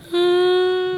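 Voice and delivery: long, high sobbing moan; real grief-stricken wail. Real, not acted. Bereaved mother too overcome to answer the reporter, wailing. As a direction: let one drawn-out wail of grief escape. Still wailing as interviewer asks.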